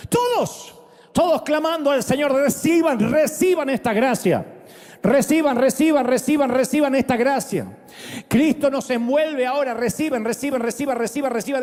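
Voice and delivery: high, driving reciban chant; rhythmic revival exhortation; fervent repeated exhortation. Acted. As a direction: press the gift on them. Urging congregation to receive.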